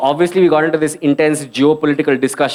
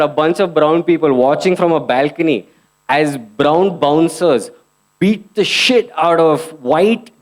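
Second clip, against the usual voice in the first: ordinary talk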